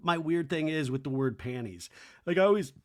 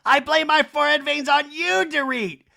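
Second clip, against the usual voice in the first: high, loud mock accusation; hammed-up high-pitched blame; Kyle; 10 semitones higher, 6 dB louder than usual. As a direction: rant it in a high impression. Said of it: "And Kyle's like".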